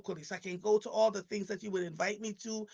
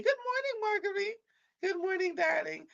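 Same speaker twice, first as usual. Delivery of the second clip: high, sing-song welcome; bright greeting; 12 semitones higher than usual. Real, not acted. Host greeting a viewer on air in a high sing-song.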